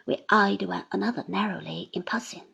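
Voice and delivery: ordinary delivery, nothing marked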